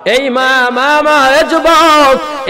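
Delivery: high, loud, chanted appeal; full-force preaching. Acted. Preacher pleading with the young men, high and loud. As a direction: cry out to the young men in a sung pitch.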